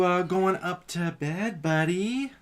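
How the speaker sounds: ordinary talk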